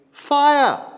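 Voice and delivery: high, loud alarm cry; raising the alarm in a crowded place, loud shout; shout of fire. Retold or imitated. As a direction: yell it like a real emergency warning.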